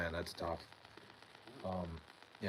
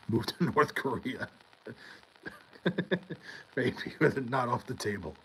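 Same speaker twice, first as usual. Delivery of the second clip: laughing; 5 semitones higher, 4 dB louder than usual. Real, not acted. Laughing at chat's suggestion.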